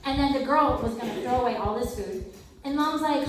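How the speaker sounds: ordinary delivery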